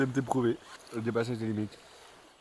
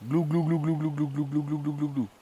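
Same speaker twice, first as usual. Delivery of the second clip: gulping noises; 2 semitones higher, 5 dB quieter than usual. Pretend. Imitating gulping sounds.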